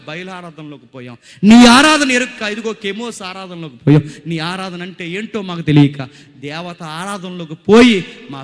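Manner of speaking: impassioned retold prayer, swelling loud; acted anguished lament. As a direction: cry out to God in a king's confession. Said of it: "తట్టుకోలేక ఏడ్చేశాడు". (ఆవేదనతో మొరపెట్టే ప్రార్థన)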